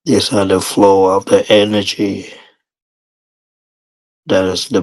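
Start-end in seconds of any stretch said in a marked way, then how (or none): none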